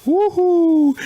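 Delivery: high, loud whooping laugh into a cheer. Acted. Sidekick cracks up at the host's joke, high whooping laugh. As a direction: cackle then whoop like a sidekick.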